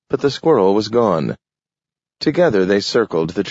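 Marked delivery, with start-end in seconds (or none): none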